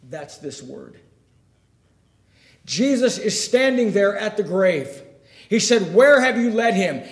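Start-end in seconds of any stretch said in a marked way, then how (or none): 2.6-7.0 loud, raised, urgent sermon voice; full-force preaching. Acted. Preacher retelling the scene at the grave, full force.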